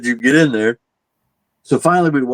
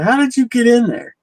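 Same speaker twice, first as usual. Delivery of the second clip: much higher, startled challenge; disbelieving demand; 13 semitones higher than usual. Retold or imitated. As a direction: fire it at him in disbelief. Arguing with coworker about room.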